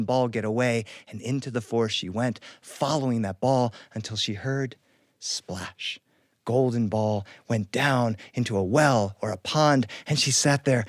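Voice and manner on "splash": breathy hushed splash sound; whispered water splash. A narrator's reading. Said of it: "unvoiced whisper splash".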